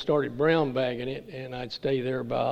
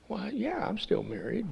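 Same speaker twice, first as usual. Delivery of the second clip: mock innocent reply; 9 semitones higher, 6 dB quieter than usual. Retelling his answer to sponsor.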